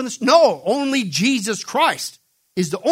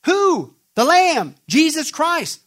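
fiery emphasis; 8 semitones higher, 5 dB louder, much slower than usual. Real, not acted. Preacher hammering home his point.

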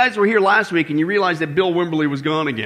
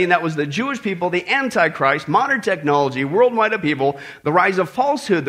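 usual speaking voice

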